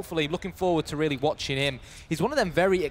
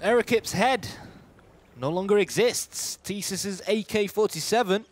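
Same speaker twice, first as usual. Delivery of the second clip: excited play-by-play; 6 semitones higher than usual. Real, not acted. Esports caster calls a sudden headshot, excited play-by-play. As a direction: burst in high, then punch out each phrase.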